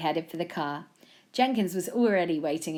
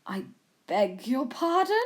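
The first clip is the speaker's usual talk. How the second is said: affronted, stuffy question; halting, indignant disbelief; offended exclamation; 7 semitones higher, 4 dB louder than usual. A narrator's reading. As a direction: take great offence, very properly. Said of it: "Jenkins exclaimed".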